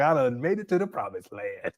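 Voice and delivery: quoting him while cracking up; laughing imitation. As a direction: giggle through the imitation. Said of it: "He's like".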